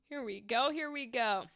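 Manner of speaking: ordinary talk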